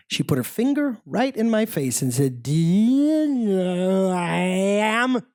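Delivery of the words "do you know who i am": put-on long, drawn-out drunken slur. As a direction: drag each word out like an indignant drunk. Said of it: "four sheets to the wind".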